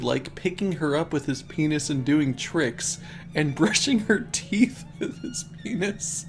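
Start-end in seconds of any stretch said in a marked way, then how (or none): 3.3-6.2 high, giggly reading; holding back laughter. Real, not acted. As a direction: read on while trying not to laugh. Laughing while reading absurd story.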